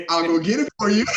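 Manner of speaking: laughing through the words. Real, not acted. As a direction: laugh as you offer it. Laughing at his own reply.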